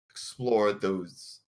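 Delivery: normal talking voice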